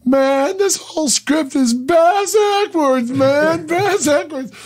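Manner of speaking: high, slow, spaced-out voice; stoned Dennis Hopper. Retold or imitated. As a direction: drawl it out like a stoned actor. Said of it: "the Hopper was perpetually stoned".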